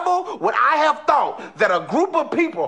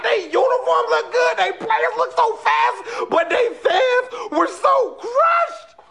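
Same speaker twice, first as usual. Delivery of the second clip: high slow wailing sob; crying wail; 9 semitones higher, slower than usual. Pretend. Wailing over Limerick's crushed fans.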